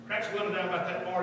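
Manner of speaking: normal talking voice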